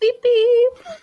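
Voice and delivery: high squeaky beep beep; boat horn beep. Shown as a demonstration. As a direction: toot it like a tiny horn. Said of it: "That's a pretty weak boat horn".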